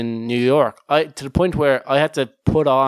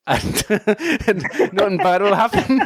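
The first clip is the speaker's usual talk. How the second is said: giggling through the words, higher pitch; laughing; 7 semitones higher than usual. Acted. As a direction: barely get it out for laughing. Mock-innocent reasoning while giggling.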